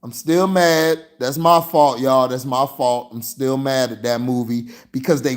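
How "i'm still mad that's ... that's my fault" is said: ordinary talk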